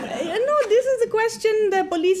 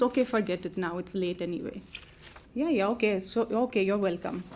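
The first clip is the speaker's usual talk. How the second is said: deflated; 7 semitones lower, 7 dB quieter than usual. Acted. Deflated, giving up.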